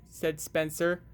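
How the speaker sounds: plain reading voice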